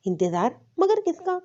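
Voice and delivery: puzzled echo of "انتظار", pitch jumping on the follow-up; surprised. A narrator's reading. Friend echoes his answer and asks for whom, surprised. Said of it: "حیرت سے کہتے".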